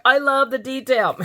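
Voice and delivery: high, emphatic burst of adoration; playful gushing. Pretend. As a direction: swing up high and gush it playfully. Gushing reversal of mock hate.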